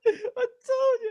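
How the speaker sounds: very high, squealing laughter through the words; helpless, high-pitched gloating laugh. Real, not acted. Friend crowing over the rhyme search result, laughing hard.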